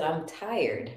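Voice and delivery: weary mimic. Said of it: "She said".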